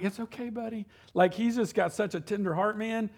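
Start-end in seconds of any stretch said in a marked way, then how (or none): 0.0-0.9 softer, gentle reassurance; comforting son. Retold or imitated. Father to crying son, softly reassuring. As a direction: soothe a crying kid. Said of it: "I'm like".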